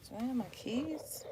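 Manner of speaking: whispered question to herself. Real, not acted. Vlogger checking for her keys, whispered. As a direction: breathe it out, no voice.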